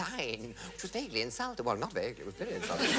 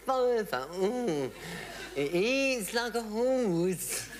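comic character voice; 10 semitones higher, 3 dB louder, much slower than usual. Retold or imitated. Comic voice in a quoted sketch.

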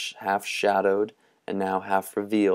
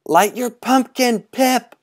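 much higher, loud urgent shout; urgent call; 6 dB louder than usual. Acted. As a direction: boys urging Pipkin to relight. Boys urging Pipkin again.

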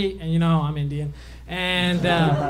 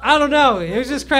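ordinary delivery

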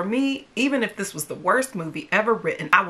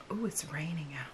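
soft whisper, much quieter; spooky whisper; 3 semitones lower, 15 dB quieter than usual. Acted. Vlogger whispering to viewers about the spooky rain. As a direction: hush for the rain sounds.

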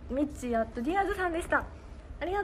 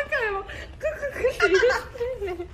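high, loud, laughing imitation of a cackle; mimicking a laugh; 11 semitones higher, 5 dB louder, faster than usual. Host imitating Yuzu's laugh. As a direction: copy her kukuku giggle while laughing.

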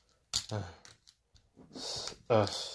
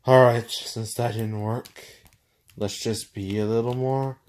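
normal talking voice

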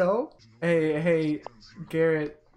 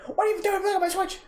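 high, rapid imitation of an annoyed brother; mock an outraged sibling, pitched way up; indignant retold squawk at a sibling; brother's angry outburst; 10 semitones higher, much faster than usual. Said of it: "He's like".

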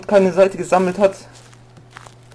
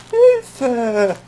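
high mock cry for help; 7 semitones higher than usual. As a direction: squeal for help half-joking. Cries for help in game.

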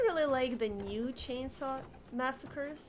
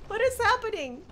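ordinary talk, nothing marked

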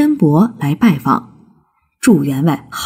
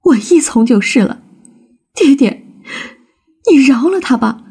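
helpless plea; 8 semitones higher than usual. A narrator's reading. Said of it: "无可奈何地说".

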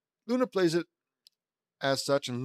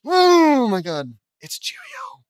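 loud, high drawn-out cry of shock; hammed-up high squeal of astonishment; startled exclamation; 13 semitones higher, 12 dB louder, much slower than usual. A narrator's reading. Acted startled cry in story.

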